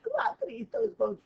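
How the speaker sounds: a little dog protesting, pitched very high and loud; silly dog voice. Retold or imitated. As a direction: go way up into a squeaky pup voice.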